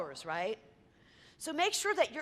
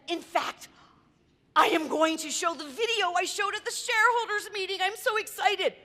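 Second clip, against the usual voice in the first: gushing excited boss; 5 semitones higher than usual. Retold or imitated. CEO boasting about his video.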